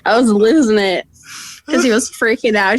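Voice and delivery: usual speaking voice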